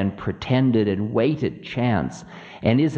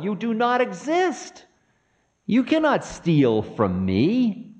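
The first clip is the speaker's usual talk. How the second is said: raised, forceful accusation; impassioned higher-pitched charge; accusing demon king; 9 semitones higher than usual. Acted. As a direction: denounce Time, pitch climbing high. Ravana accuses Time in the tale.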